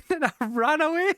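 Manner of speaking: high, giggling punchline; cracking up on the punchline of the story; laughing. Real, not acted. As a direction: laugh it out as you finish.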